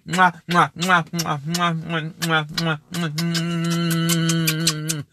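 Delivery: rapid string of mwahs; string out kisses, holding the last one long; blown kisses. Pretend. Host signing off to everybody, playful repeated air kisses.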